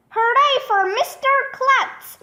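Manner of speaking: high, cheering hooray; shouting. A narrator's reading. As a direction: cheer with pitch way up, volume held back. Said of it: "Everybody started shouting".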